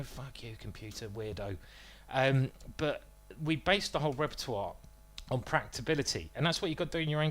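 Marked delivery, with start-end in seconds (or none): 0.0-1.6 softer, dropping-off dismissal; muttered insult. Acted. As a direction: toss it away under your breath. Dismissing the computer in an aside.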